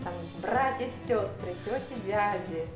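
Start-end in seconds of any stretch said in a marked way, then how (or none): none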